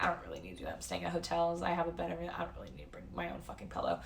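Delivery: quiet self-talk about the pillow; inner-voice mutter. Retold or imitated. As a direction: mumble it to yourself, dismissive. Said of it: "but I was like".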